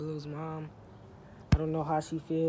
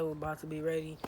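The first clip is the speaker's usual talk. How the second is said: normal talking voice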